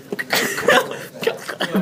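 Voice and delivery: voiceless rattling creature noise; imitated creature clatter. Game master's hobgoblin dropping on all fours, rattling noise. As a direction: make a scuttling throaty rattle. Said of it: "gets on all fours and goes".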